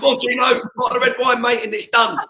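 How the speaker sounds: high, loud cheerful hollering; loud call. Real, not acted. Urging a friend to bring the red wine, loud and high. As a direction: holler to get him moving.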